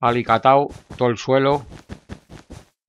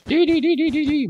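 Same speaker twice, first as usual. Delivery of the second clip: high falsetto ti-ti-ti trill; playful high-pitched vocal beeps; silly beeping. Pretend. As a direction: squeak out a string of tiny beeps. Playful noises while building.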